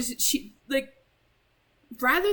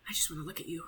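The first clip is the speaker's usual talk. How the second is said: creepy whisper; 5 semitones lower, 10 dB quieter, much faster than usual. Acted. Yuri whispering to the player, eerie whisper. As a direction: whisper it, low and close. Reading Yuri's creepy line.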